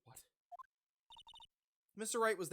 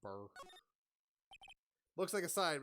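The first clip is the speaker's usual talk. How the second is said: ordinary talk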